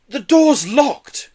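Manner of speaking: high, loud, drawn-out dismay; dismayed groan. A narrator's reading. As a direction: groan as hope slips away. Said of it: "groaned Joe".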